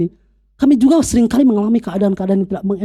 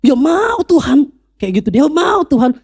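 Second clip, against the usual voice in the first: higher, slow cry of yes to God; mock joyful yell; 6 semitones higher than usual. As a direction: act out an eager cry, half-shouted. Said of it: "dia teriak". (teriakan girang yang ditirukan, tinggi dan lambat)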